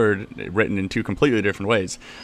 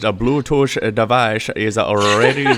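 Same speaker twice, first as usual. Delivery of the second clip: put-on slow, stilted device announcement with a Chinese accent; Bluetooth lady voice; 2 semitones higher, slower than usual. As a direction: imitate a cheap electronics voice prompt. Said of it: "the most hilarious Chinese voice".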